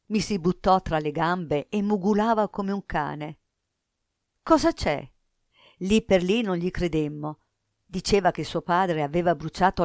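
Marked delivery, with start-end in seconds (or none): 4.4-5.1 higher-pitched, startled question; quick, raised-pitch demand to know. A narrator's reading. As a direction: ask in sudden alarm. Startled question to frantic boy.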